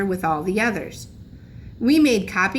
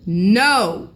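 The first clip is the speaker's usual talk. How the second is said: put-on louder, cross old man's no; angry shout; 5 dB louder than usual. A narrator's reading. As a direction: snap the no at the officer. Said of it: "shouted Grandpa angrily".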